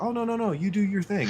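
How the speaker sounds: high, put-on dismissive voice; mimicking a complainer. Streamer mimicking the guy's comeback, high mocking voice.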